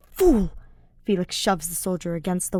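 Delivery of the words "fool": loud contemptuous shout; angry bark. A narrator's reading. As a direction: spit the word. Felix insults the soldier.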